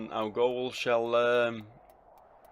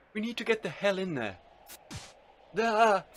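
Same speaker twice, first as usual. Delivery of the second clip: urgent outcry; 9 semitones higher than usual. Acted. Character desperate to get inside, high urgent cry. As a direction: burst out in acted desperation. Acted urgent scene at locked door.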